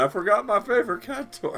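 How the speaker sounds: squeaky high whiny customer; high mock customer voice.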